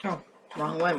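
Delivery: ordinary talk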